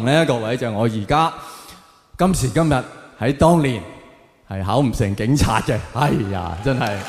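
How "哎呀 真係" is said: ordinary talk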